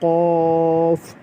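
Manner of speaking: क़ाफ very slow, with a heavy echo; qalqala demo. Shown as a demonstration. Teacher repeating क़ाफ for the class, drawn out with an echo. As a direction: stretch out क़ाफ and bounce the end. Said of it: "क़ाफ कलकला करके".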